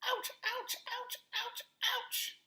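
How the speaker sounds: very high squawking emu yelps; emu shouting ouch. Acted. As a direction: yelp like a stung bird.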